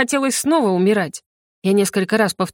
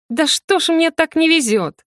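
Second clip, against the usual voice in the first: high-pitched wail of complaint; exasperated lament; 8 semitones higher than usual. A narrator's reading. Heroine hanging over a river, exasperated lament. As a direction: bemoan your rotten luck. Heroine bewails luck while falling.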